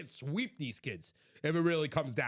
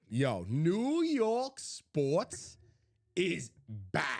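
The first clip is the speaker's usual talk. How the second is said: emphatic hype; much slower than usual. Real, not acted. Co-host proclaims a New York comeback, slow and emphatic. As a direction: stretch each word out for emphasis. Hype declaration after whispered take.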